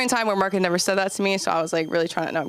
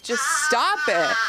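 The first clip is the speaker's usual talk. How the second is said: higher, drawn-out exasperation; imitating a frazzled teacher, slow and high; exasperated plea; 6 semitones higher than usual. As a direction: stretch it out, fed up and pleading. Acted exasperated teacher in retelling.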